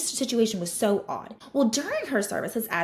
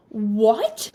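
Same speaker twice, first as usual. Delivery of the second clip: disbelief; 6 dB louder than usual. Retold or imitated. Learning the client came early.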